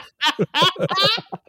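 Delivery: shrieking high burst of laughter; a squealing laugh, way up. Real, not acted.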